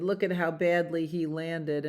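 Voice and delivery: ordinary delivery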